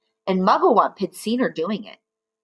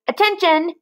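high barked command; fearsome monkey trainer; 10 semitones higher, 4 dB louder than usual. Acted. Mister Twit commanding the caged monkeys, barked order. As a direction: snap them to attention like a trainer. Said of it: "he barked in his fearsome monkey trainer's voice".

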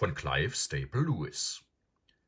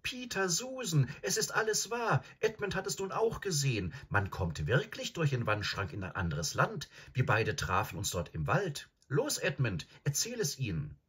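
put-on high, eager girl's voice tumbling out; breathless young girl bursting with news; bubbling over; 9 semitones higher than usual. A narrator's reading. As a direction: blurt it all out excitedly. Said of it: "sprudelte Lucy los".

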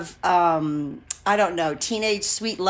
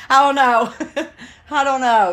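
flustered, higher laughing reply; 5 semitones higher, 6 dB louder than usual. Real, not acted. Vlogger teased about her first love, laughing. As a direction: laugh through the words, a bit louder.

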